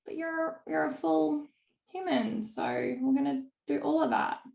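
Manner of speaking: coaxing a client to see the whole picture; earnest coach. Retold or imitated. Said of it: "It's like".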